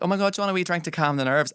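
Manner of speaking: high, quick fussing over a nervous performer; mock fussy offer. Backstage helper offering a wee drink, high and rushed.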